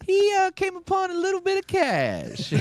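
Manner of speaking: high, loud, grand announcer voice; pompous mock announcer. He introduces his friend to the woman in a grand voice. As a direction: present him like a fancy herald.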